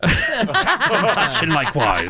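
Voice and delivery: slow, drawn-out fake British; teasing British imitation; mock British accent. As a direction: parrot the British guy, laughing. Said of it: "That's how they talk".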